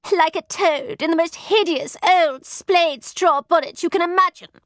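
high, disgusted snap; snapped. A narrator's reading. Satin describing the old woman after her tears, snapping. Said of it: "snapped Satin".

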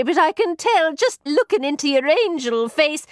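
put-on wheedling old Cockney crone, high and slow; imitated Cockney. A narrator's reading. Maid mimicking the beggar's plea to her mistress, imitated Cockney. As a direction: put on a pleading Cockney accent. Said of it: "trying to imitate a Cockney accent".

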